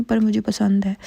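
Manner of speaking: normal talking voice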